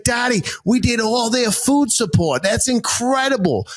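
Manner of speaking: thrilled Boston local; Boston accent. Retold or imitated. Driver thrilled, singsong Boston accent. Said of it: "switch accents quick".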